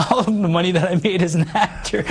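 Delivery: chuckling through the words; laughing. Real, not acted. Guest laughing to the host about money spent. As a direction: laugh it off ruefully.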